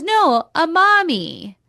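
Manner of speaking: hammed-up high insistent no, a mommy; insistent mock mommy. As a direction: correct him with exaggerated insistence. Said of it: "she goes".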